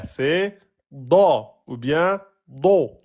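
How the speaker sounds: heavy slow back da and do; emphatic vowels. Shown as a demonstration. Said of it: "C'est pas de".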